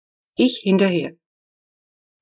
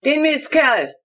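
high, loud, slow gloating cry; triumphant; 9 semitones higher, 4 dB louder, slower than usual. A narrator's reading. Neighbour gloating over the animal he shot, triumphant. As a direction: crow over the kill. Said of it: "hört man Unwisch triumphieren".

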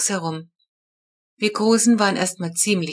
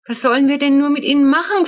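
higher, louder and rushed; a mother at her wits' end pleading; desperate question; 8 semitones higher, 6 dB louder, faster than usual. A narrator's reading. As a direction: mum asking the vet in despair. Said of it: "fragt sie ganz verzweifelt".

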